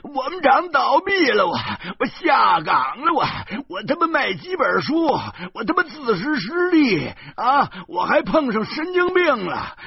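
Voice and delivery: very high, loud, near-crying tirade; shrill anguished wail. Acted. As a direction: wail out your hardships, building to a desperate shout. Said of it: "小贩儿凄厉地说". (凄厉地哭喊诉苦)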